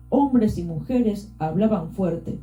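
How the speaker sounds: plain reading voice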